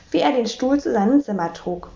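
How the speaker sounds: usual speaking voice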